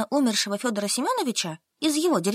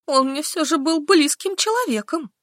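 tearful; 6 semitones higher, 3 dB louder than usual. Acted. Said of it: "всплакнула".

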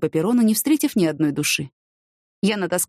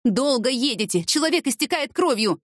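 indignant, raised pitch without shouting; angry reply; 6 semitones higher than usual. A narrator's reading. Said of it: "гневно ответила им Яна". (гневный упрёк повышенным тоном)

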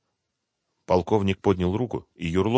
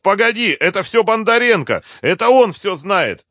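very high, loud frantic denial; shouting; 14 semitones higher, 9 dB louder than usual. A narrator's reading. Captive blames his partner to avoid a beating, shouting. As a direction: cry out, shifting all the blame. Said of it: "крикнул Юрлов".

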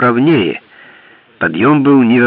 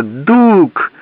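high desperate cry; 8 semitones higher than usual. Acted. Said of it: "закричала она".

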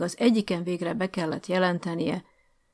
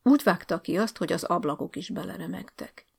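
ordinary delivery

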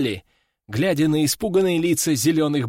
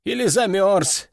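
higher-pitched thoughtful guessing; pondering officer in a lifted voice; musing; 6 semitones higher than usual. A narrator's reading. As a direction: speculate aloud, unsure. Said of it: "задумался майор".

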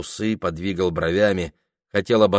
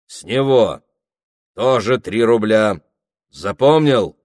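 louder, clipped orders to a servant; stern commanding; 3 semitones higher, 5 dB louder than usual. Acted. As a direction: lay down the law. Prokhor gives stern orders.

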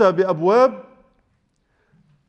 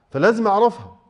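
normal talking voice

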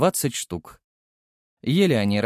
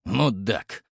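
scoffing. A narrator's reading. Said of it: "хмыкнул любитель харкаться".

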